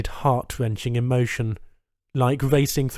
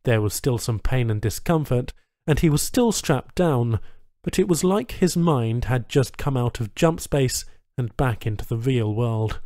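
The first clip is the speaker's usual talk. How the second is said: usual speaking voice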